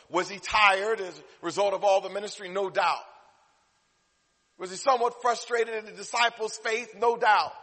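high, loud rhetorical questions from the pulpit; forceful preaching. Real, not acted. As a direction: ask and answer with a preacher's force. Preacher asks and answers questions.

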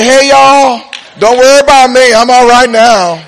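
cheerful reassurance called down; mama from heaven. Pretend. Mama calling down to her grieving family, carefree. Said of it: "She looks over every now and then and says".

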